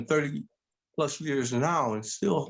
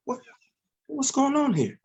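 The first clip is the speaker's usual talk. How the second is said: higher, rising into a startled what's going on; retelling his own confused reaction, pitched higher; baffled; 14 semitones higher than usual. Retold or imitated. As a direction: act caught off guard, voice climbing. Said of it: "I'm looking at him like".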